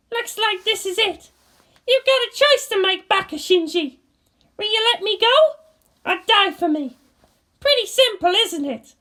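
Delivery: high mocking challenge; bitter taunt. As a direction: dare him coldly. Asuka resigned taunt.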